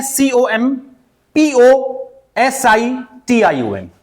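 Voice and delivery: letter by letter with gaps; spelling out. Shown as a demonstration. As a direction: spell "पीओएसआईटीआईओएन" slowly in letter groups. Teacher spells out the word.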